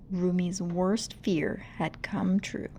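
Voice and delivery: ordinary delivery, nothing marked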